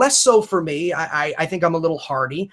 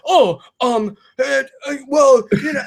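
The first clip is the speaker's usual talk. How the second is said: higher, halting awkward mumbling; flustered stammer; 8 semitones higher, 4 dB louder than usual. Retold or imitated. Critic greeted by the podcaster he hates, flustered stammer. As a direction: imitate someone caught off guard, fumbling for words. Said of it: "And he's like".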